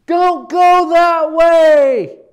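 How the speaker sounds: high, loud shout with a slow stretched warning; hollering down. Pretend. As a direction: yell a warning down the mountain. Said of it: "they start hollering down to you".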